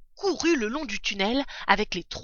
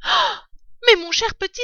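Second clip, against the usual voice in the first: higher, louder surprised exclamation; exclaiming; 11 semitones higher, 6 dB louder than usual. A narrator's reading. Mother fox seeing the hens, surprised exclamation. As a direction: cry out in glad astonishment. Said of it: "s'exclama-t-elle".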